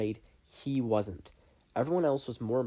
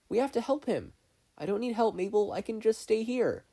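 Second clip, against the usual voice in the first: higher voiced kids pleading; young girl and boy voices; 11 semitones higher, 3 dB louder than usual. Voices characters in dialogue.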